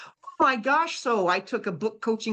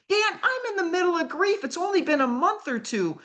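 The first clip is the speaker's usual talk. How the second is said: higher, pleading objection; pleading protest; 9 semitones higher than usual. Retold or imitated. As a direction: push back, pleading grief. Refusing, mid grief.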